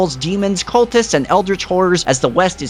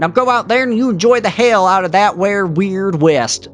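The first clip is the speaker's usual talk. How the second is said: put-on old prospector; 4 semitones higher than usual. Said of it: "I did it one more time".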